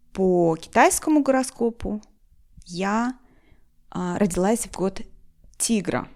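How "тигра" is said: ordinary talk, nothing marked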